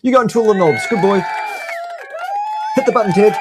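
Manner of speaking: high, talking down to Ted; teasing a producer like a child, high-pitched; mock condescension. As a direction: pat Ted on the head with words.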